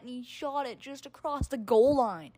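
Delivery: ordinary delivery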